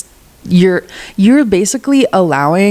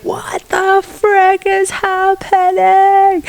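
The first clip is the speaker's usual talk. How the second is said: much higher, slow squeal of despair; mock despair; 13 semitones higher, much slower than usual. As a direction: wail it like you're losing it. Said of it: "be like".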